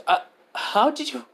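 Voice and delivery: high-pitched, slow, stunned question; falter, caught out by his guess; taken aback. Acted. Girl stunned he guessed about her past, taken aback.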